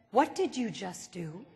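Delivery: soft, low, quietly menacing question. Retold or imitated. Husband quietly confronting his wife over the wipers, soft tone. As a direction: ask it softly, dangerously calm. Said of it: "he said in a very soft tone".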